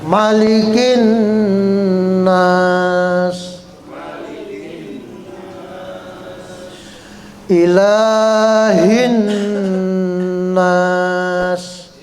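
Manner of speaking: slow drawn-out melodic chant; Quran recitation. Shown as a demonstration. Teacher reciting the whole surah for students. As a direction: recite the surah slowly with tajwid.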